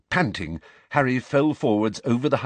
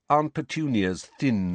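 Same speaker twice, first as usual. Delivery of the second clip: ordinary delivery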